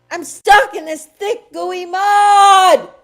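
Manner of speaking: high, loud desperate shout; panicked cry. Acted. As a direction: cry out, trapped and sinking. Trapped in mud, crying out.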